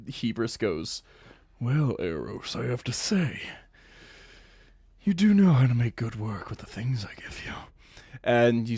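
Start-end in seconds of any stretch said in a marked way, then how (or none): 1.6-7.5 seductive whisper. Acted. Hebrus seductive line to sadist.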